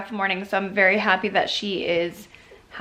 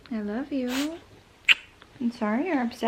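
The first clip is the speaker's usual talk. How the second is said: very soft, tender reassurance; soothing; 8 dB quieter than usual. Real, not acted. Mother to upset toddler, soft soothing voice.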